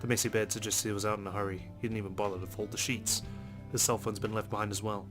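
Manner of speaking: ordinary delivery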